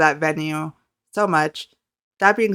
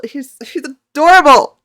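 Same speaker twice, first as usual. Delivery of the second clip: high, loud, drawn-out fan squeal; overwhelmed with adoration, pitch soaring; gushing; 7 semitones higher, 9 dB louder than usual. Real, not acted. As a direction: gush it slowly and loudly. Gushes over the idol, repeating.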